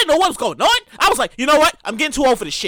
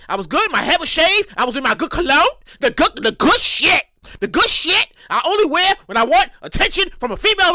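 usual speaking voice

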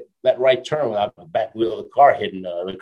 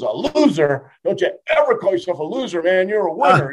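imitating an upset boxer, higher and insistent; lightly acted scolding, rising to a high laughing finish; mock outburst; 7 semitones higher, 3 dB louder than usual. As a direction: boxer scolding a stranger for calling himself a loser. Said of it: "he goes nuts".

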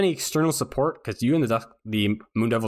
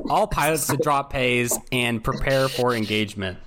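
normal talking voice

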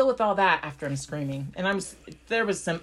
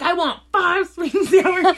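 loud, high mock demand, laughing through it; playful mock-demanding voice, laughing; demanding order; 11 semitones higher, 7 dB louder than usual. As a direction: bark the order like you're owed five sauces. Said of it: "and I'm like".

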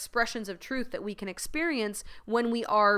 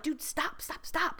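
softer, hissed stop stop stop; urgent hush; 6 semitones higher, 8 dB quieter, slower than usual. Retold or imitated. To her best friend at the table, a soft urgent hush. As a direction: shush her friend before the waiter notices. Hushing friend so waiter won't notice.

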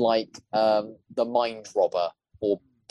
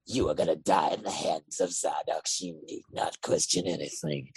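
low, soft, flat menace in a different voice; Kovacs voice; 7 semitones lower, 5 dB quieter than usual. Shown as a demonstration. Contrasting Zardox's lines in the Kovacs voice, low and soft. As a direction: drop into the other villain's voice, lower and quieter. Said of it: "suddenly being like".

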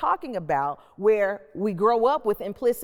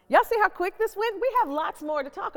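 excited; 12 semitones higher, 4 dB louder than usual. Real, not acted. Host wrapping up, amazed at time.

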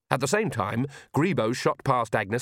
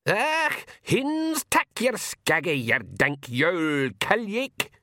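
high, slow screech of a tiny warrior; screaming tiny Feegle; 8 semitones higher, much slower than usual. A narrator's reading. Tiny blue man screams at the cat, high and slow. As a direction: shriek the gibberish like a furious little man. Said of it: "he screamed".

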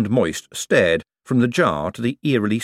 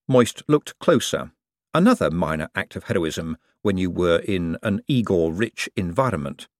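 plain reading voice

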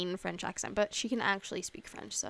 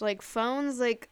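normal talking voice

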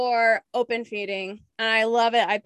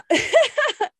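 high loud laugh; 6 dB louder than usual. Real, not acted. Laughing at own story.

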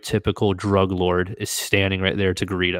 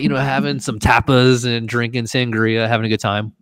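usual speaking voice

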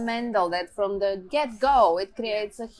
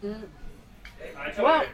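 talking with a mouth full of ice cream; 2 semitones higher than usual. Real, not acted. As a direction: mouth full, wincing at the cold. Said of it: "sorry, that's the ice cream and my sensitive teeth".

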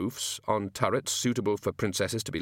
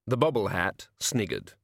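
plain reading voice

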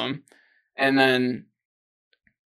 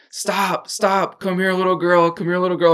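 higher-pitched mock calling after a girl; lightly acted stranger's call, pitched up; retold calling out after someone; 4 semitones higher than usual. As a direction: imitate a man calling to someone he is chasing. Stranger chasing her, calling out.

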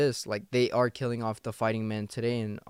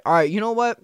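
higher, louder, defiant snap; fed up, finally hitting back; Putin fed up; 5 semitones higher, 5 dB louder than usual. Retold or imitated. Putin answering the taunts in a louder, higher defiant voice. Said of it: "that's why he's like".

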